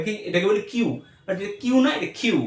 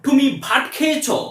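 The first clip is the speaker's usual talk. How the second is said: Bengali in a mock foreign accent, slow and high; foreigner's accent; 4 semitones higher than usual. Foreigner asking a Bengali speaker their name, foreign accent. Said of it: "কোনো বিদেশি যদি আমাকে জিজ্ঞেস করে".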